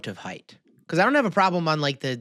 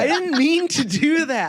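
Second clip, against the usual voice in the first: high, louder, slowed through giggles; laughing protest; 13 semitones higher, 5 dB louder than usual. Real, not acted. Guy insists slip was unintentional, laughing. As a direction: protest innocence while laughing.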